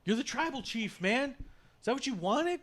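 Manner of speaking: high-pitched acted challenge; high, strained mock demand; retold exasperation. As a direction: imitate a wronged man questioning his rival. Acting an exasperated wrestler's challenge.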